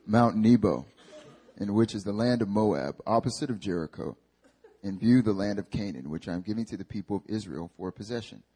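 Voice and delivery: low-pitched voice of God. Acted. God tells Moses to view Canaan, in a low God voice. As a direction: drop low and read it as God. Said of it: "I can't speak that low forever".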